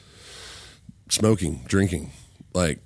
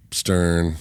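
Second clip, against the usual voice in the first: ordinary talk, nothing marked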